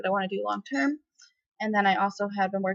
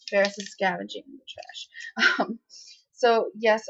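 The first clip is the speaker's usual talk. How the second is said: usual speaking voice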